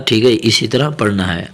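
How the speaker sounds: ordinary talk, nothing marked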